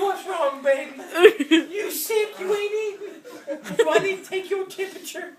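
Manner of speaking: high doting nanny voice, laughing; nanny worrying over a child who won't eat, high and doting; Ruby fussing. Retold or imitated. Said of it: "And she go".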